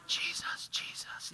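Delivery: breathy whispered mock intoning of the name; hushed pastor whisper. Pastor intoning to the crowd at the pool, mock whisper. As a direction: whisper it like a pastor conjuring holy fog.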